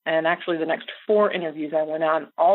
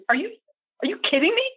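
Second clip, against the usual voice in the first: incredulous mentor; 11 semitones higher, much faster than usual. Retold or imitated. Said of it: "She goes".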